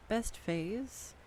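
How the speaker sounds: usual speaking voice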